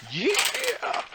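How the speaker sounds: high triumphant yeah; excited yelp. Real, not acted. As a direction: cheer the hit. GM cheers a hit.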